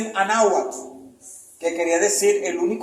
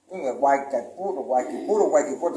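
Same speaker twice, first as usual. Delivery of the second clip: Guaycaipuro sounded out lower, in a fumbling foreign way; imitating Spanish colonist; 4 semitones lower than usual. Retelling the conquest, mimics a Spaniard fumbling Guaycaipuro. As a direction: sound out Guaycaipuro like a Spaniard who can't catch the name. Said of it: "¿cómo que se llama el indio que matamos ahorita?".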